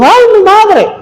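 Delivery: high squealing cry of a burned sister; high mock pain squeal; mock yelp of pain. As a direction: yelp like hot lard just hit you. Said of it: "y dijo".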